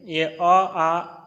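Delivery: अ clipped short, then आ stretched long, very slowly; short and long vowel. Shown as a demonstration. Teacher to students, showing vowel length slowly. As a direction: draw out आ so its length is heard next to अ. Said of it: "अब दीर्घ में आ जाइए".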